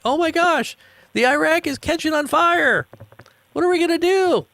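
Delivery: very high, squealing mock panic. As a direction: shriek like a panicked reporter. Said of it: "they're like".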